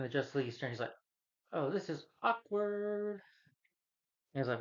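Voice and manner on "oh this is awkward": high, very slow, drawn-out awkwardness; mock embarrassed hero, much higher; awkward. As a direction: stretch the awkward out. Justice League awkward reaction.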